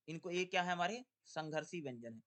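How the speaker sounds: ordinary delivery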